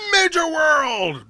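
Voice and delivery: high, loud drawn-out shout; imitating ad pitchman. Hoping the Spanish ad guy would say it, mock pitchman shout. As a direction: stretch the dealership name into a yell. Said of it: "waiting for him to be like".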